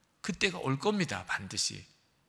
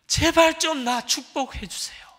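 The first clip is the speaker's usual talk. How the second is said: high, loud, pleading cry to God; lamenting prayer; 8 semitones higher, 6 dB louder than usual. Retold or imitated. Poor student crying out to God in despair, pleading loudly. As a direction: beg God desperately, voice straining up. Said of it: "탄식의 기도가 드려지더래요".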